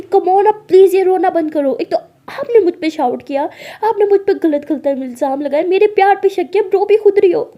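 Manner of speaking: loud, high complaint; exasperated scolding. Acted. Alia scolds her crying partner over false blame, loudly exasperated. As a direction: list your grievances, exasperated.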